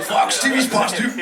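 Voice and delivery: slurring it like a wasted Glaswegian; a hint of a drunk Scottish mate; thick Scottish drunk.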